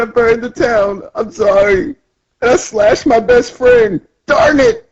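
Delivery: high, wailing confession; hammed-up tearful remorse; mock crying. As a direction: blubber it in a very high voice. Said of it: "he's crying".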